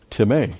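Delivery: time said as tih-MAY; Greek pronunciation. Shown as a demonstration. Teacher giving the Greek word, time pronounced tih-MAY. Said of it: "Now, this is not time".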